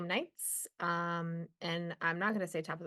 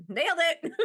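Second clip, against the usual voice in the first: self-mocking triumph; 10 semitones higher, 8 dB louder than usual. Real, not acted. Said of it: "self-mocking triumph".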